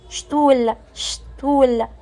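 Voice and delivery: شتول said slowly with sht at the start, like German Stuhl. Shown as a demonstration. Teacher drilling the German word Stuhl, slow sht. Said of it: "بيتنطق شت".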